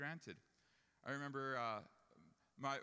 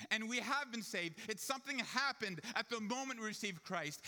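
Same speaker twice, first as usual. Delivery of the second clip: raised, louder pulpit voice; fervent preaching; 7 semitones higher, 5 dB louder than usual. Acted. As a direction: proclaim it with full conviction. Preaches at full force.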